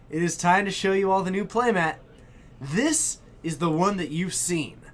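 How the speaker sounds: normal talking voice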